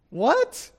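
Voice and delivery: high, loud, outraged wife; a shocked wife's squawk of disbelief; indignant wife. Retold or imitated. Wife hears herself called his sister, outraged.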